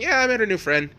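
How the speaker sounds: a mock-innocent lilt; cheerful reply. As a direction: play it lightly pleased and sing-song. Acts Deku's cheerful reply.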